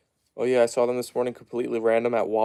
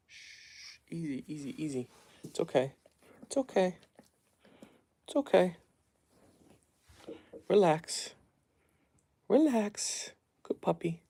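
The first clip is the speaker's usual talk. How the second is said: soft, hushed calming voice, mostly higher; hushed soothing; 5 semitones higher, 9 dB quieter than usual. Real, not acted. Owner hushing and calming his nervous dogs, soft and soothing. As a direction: gently settle a nervous dog.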